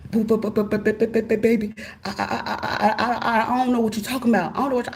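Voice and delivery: flustered, stuttering denial; stammering panic. Retold or imitated. Caught husband stammering a denial, flustered. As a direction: stumble over every word.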